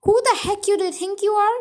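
loud, fast, angry yell; furious shout. Acted. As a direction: bark it at the cheeky daughter. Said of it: "he shouted".

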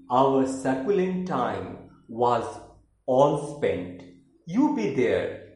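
declaimed love poem, opening high and emphatic; dramatic, deliberate verse reading; poetry recitation. Acted. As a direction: recite with swelling stresses, settling slowly at the close. Recites second poem, Love Unconquered.

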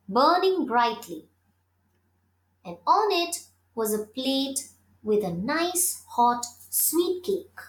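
plain reading voice

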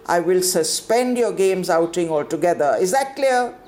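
high, loud stern warning. Acted. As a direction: threaten the pupil firmly. Warden threatening punishment.

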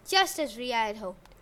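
ordinary delivery, nothing marked

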